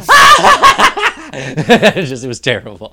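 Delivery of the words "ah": very high, very loud scream through laughter; laughing scream. Retold or imitated. Friend on the rope screaming at the sudden jolt, laughing scream. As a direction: shriek it, cracking up. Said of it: "And my friend's like".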